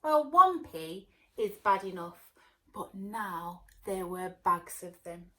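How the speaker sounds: plain reading voice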